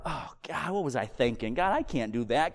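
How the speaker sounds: low, soft self-reproach; acted sulking. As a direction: sulk quietly to yourself in defeat. Sulking in failure, acted self-talk.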